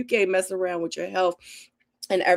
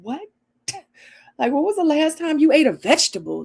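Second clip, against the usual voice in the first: mock-shocked mom; 9 semitones higher than usual. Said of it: "I'm like".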